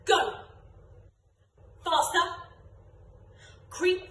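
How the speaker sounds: rapid-fire game caller; called commands. Shown as a demonstration. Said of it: "I'm gonna do it in any order".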